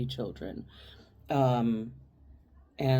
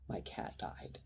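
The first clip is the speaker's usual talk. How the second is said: barely voiced, very soft; retold grief, almost whispered; small, hushed admission; 16 dB quieter, slower than usual. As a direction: let it out quietly. Retold grieving answer to inmates.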